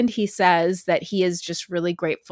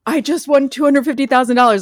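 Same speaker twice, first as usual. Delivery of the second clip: louder, higher retold exclamation; imitated excited winner; 5 semitones higher, 5 dB louder, faster than usual. Re-quoting the winner in viral footage, excited imitation. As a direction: proclaim the win as if on camera.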